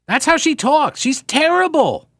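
fed up, dragging the words out; exasperated. Real, not acted. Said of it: "high, slow, exasperated".

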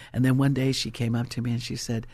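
ordinary talk

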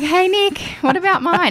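high, whiny mock complaint. Left-out friend asks Nicole for a freebie, mock whiny.

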